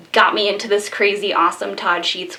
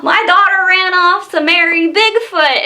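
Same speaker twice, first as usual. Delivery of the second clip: higher-pitched hammed-up caller voice; 9 semitones higher, 3 dB louder than usual. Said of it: "probably redneck or high or something".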